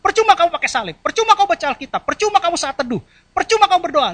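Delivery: the devil taunting a believer, loud and high; devil accusing. Retold or imitated. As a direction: hammer each percuma like the accuser. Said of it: "seakan-akan gitu".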